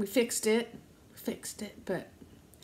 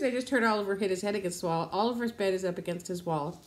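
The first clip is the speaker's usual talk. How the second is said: ordinary talk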